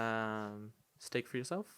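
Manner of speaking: hushed, polite waiter voice; very soft. Shown as a demonstration. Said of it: "I, I talk very soft".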